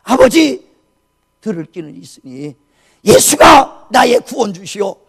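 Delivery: loud, high, slow exhortation; urgent shouted plea. Retold or imitated. Son at dying father's ear, urgent shouted plea. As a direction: call out forcefully with drawn-out words. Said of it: "간절히 소리치며 기도합니다".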